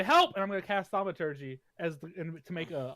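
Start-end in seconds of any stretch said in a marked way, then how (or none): 0.0-0.3 high, loud yelp for help; cry for help. Acted. Prisoner yells for help to fake a seizure, high and loud. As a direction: call out for help in mock panic. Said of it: "I'm gonna say".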